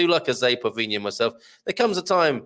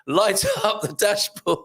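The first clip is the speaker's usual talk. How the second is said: higher read breaking into laughter; laughing; 13 semitones higher than usual. Real, not acted. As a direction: crack up while reading it out. Cracks up reading a comment.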